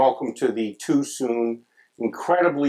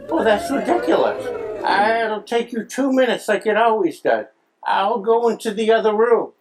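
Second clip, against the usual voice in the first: much higher, loud mother voice; insistent widowed mom; 11 semitones higher, 5 dB louder than usual. Acted. Widowed mom coaxes her son to come over, high and insistent. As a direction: brush off the objection in high mom voice. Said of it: "all these poor widowed moms".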